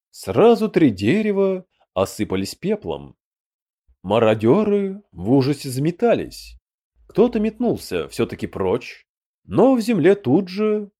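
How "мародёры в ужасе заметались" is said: ordinary delivery, nothing marked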